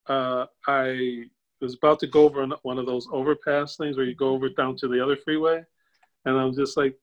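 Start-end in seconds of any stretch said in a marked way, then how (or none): none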